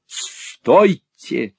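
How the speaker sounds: loud, authoritative order to stop; ringing cry. A narrator's reading. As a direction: call a halt, high and firm. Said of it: "таким голосом, что загудели стропила".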